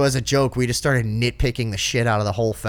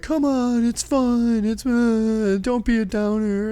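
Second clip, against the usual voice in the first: high, drawn-out wheedling; guilt-tripping festival-goers; 10 semitones higher, slower than usual. Retold or imitated. Festival-goers pressuring him to join in, wheedling. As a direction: coax in a high mocking whine. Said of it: "try to guilt you into it".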